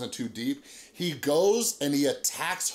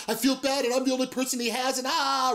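high, put-on whine of pity; mock pleading; 10 semitones higher than usual. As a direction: imitate her wailing excuse in a high voice. Mimics her saviour pleading.

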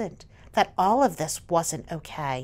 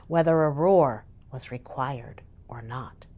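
whisper; 8 semitones lower than usual. A narrator's reading. Whispered aside in story.

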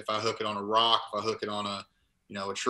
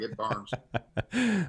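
laughing. Retold or imitated. Laughing retelling of burning taste.

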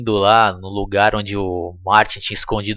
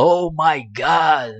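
very slow, drawn-out higher gasp of shock; hammed-up stunned inventor; dramatic shock; 6 semitones higher, much slower than usual. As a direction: stretch it out in mock horror. Doc's shocked reaction, in English.

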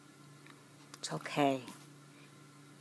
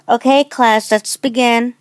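teacher addressing class; 5 semitones higher, 9 dB louder, slower than usual. Acted.

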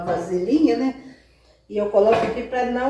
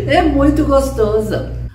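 laughing with delight; 6 semitones higher, 5 dB louder than usual. Real, not acted. Delighted tasting.

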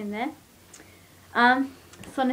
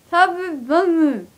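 slow and slurred around a full mouth; 6 semitones higher, 6 dB louder, slower than usual. Real, not acted. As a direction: talk through cheeks stuffed with marshmallows. Seven marshmallows in mouth.

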